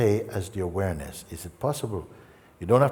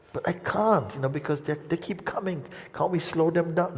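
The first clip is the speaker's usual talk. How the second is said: higher, mock pleading student; pleading seeker; 8 semitones higher than usual. Seeker pleading with the teacher, higher mock plea.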